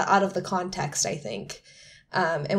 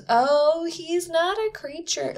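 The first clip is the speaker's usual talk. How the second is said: higher, slow mock gasp of surprise; 9 semitones higher, slower than usual. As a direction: play her stunned, drawn-out realisation. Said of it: "and she goes".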